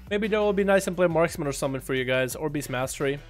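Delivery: ordinary delivery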